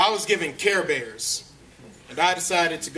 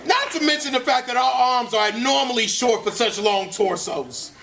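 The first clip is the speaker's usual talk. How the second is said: irritable cartoon bear telling his showbiz story, pitched higher; higher-pitched, put-upon storyteller; Grumpy Bear; 6 semitones higher than usual. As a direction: gripe through a jaded actor's memoir.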